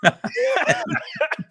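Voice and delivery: very high, squealing laugh; high giggle. Real, not acted. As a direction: burst into a high-pitched giggle. Laughing at his own joke.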